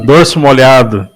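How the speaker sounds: ordinary talk, nothing marked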